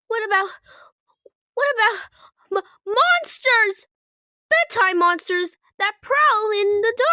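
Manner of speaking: put-on frightened baby Kermit. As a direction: stammer out the monsters in fear. Kermit fears monsters.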